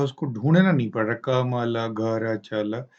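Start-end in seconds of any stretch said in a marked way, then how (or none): 1.1-2.8 कमल घर चल dragged out word by word; very slow halting reading. Shown as a demonstration. Teacher showing the struggling reading to avoid, very slow. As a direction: stretch each word, searching for it. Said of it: "ये नहीं कर रहा है".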